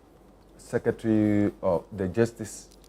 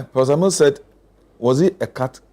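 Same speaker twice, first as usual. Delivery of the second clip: louder and higher, insistent; raised voice, pressing the point; loud interjection; 4 semitones higher, 7 dB louder, faster than usual. Real, not acted. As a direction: call out the comeback over the room. Interjects with the counter-quote.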